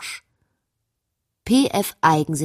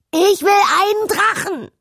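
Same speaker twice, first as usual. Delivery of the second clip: put-on much higher and louder, a child's shouted demand; 7 dB louder than usual. Princess demanding a dragon from her parents, shouted high. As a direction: belt it out like a spoiled princess. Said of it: "Und laut".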